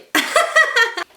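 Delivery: high embarrassed giggle; high laugh. Real, not acted. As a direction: laugh high at your own fail. After failing with the lens.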